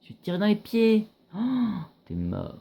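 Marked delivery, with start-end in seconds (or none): 0.0-1.4 "Je vais te tirer dans les pieds" repeated much higher with rising glee; high mocking gamer taunt; gleeful taunt. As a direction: jeer at the enemy, pitch way up. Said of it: "Regarde-moi ça".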